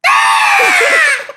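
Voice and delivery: very high, loud mock shriek; piercing schoolgirl scream, hammed up; random girl's scream. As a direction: scream shrilly for no reason. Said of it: "That girl in random class going".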